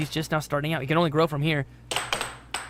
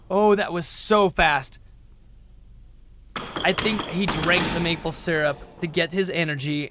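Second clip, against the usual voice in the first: ordinary talk, nothing marked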